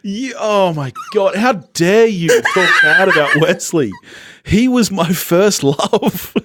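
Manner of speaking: mock outrage. Defending her first love Wesley, laughing mock outrage. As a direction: play up being scandalised, then swoon. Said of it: "mock outrage defending Wesley".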